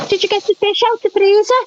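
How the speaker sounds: high-pitched imitation of mum; mum's questioning. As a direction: call it out like a mother walking in. Acting out mum coming in.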